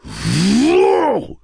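a furious high roar at the killer. Acted. Grieving father roars at Roland and Jake. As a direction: roar it out, full force. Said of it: "rugit Slightman".

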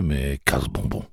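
plain reading voice

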